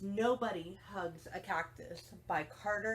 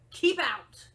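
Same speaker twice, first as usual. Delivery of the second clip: loud, higher angry shout; angry yell; 6 semitones higher, 9 dB louder than usual. Acted. Hank yells at the tortoise to stay away, loud and angry. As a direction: drive him off with a yell. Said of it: "yelled Hank".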